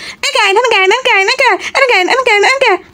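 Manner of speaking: put-on high, repeated child whine; high kid's hungry whine; hungry whining child. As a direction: whine for food like a kid.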